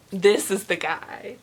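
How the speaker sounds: usual speaking voice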